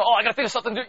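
preacher mocking a panicked God he says never appears; mock panicked fretting. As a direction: ham up a flustered panic. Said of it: "You don't see him freaking out".

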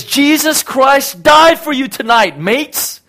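high, loud shouted proclamation; drawn-out shout to drunk partygoers; retold scream. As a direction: yell it from a truck bed to the crowd. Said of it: "he just screams from the top of his lungs".

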